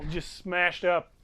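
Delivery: loud, punchy game master storytelling; game master combat narration. Acted. Game master describing hammer blows to players, emphatic narration. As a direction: narrate the beating with heavy emphasis.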